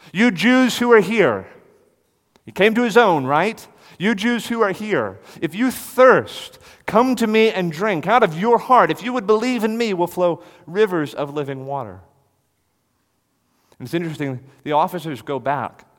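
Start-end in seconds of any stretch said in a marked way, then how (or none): 0.1-12.0 loud, high preaching call to the crowd; voicing Jesus calling out. Preacher calling out as Jesus to the crowd, loud and high. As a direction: cry it out to the whole crowd.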